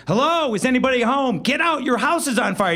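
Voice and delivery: high, urgent retold shout of warning; hammed-up high alarm shout; mock neighbor yell. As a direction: call out like a panicked neighbor. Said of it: "I hear people screaming".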